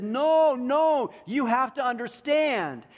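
loud, high, urgent quoted plea; insistent parents. Retold or imitated. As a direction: voice parents insisting emphatically, drawing it out. Voices parents' insistent denial to child.

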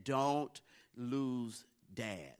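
very slow, each word set apart; grave imitated warning. Brothers warning scared Cameron, slow and ominous. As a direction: draw out a mock-dire warning, word by word.

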